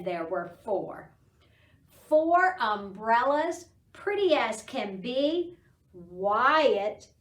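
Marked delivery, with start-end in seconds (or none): none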